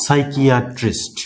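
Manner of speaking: সাইকিয়াট্রিস্ট said slowly as "saikiatrist" with no p; drawn-out model of সাইকিয়াট্রিস্ট, p dropped; careful teacher model, silent p; slow silent p demo. Shown as a demonstration. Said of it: "এটার উচ্চারণ যদি আমি করি".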